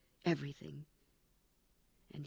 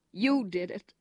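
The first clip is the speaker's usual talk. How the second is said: sobbing accusation; 5 semitones higher, 9 dB louder than usual. Acted. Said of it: "sobbed Winston".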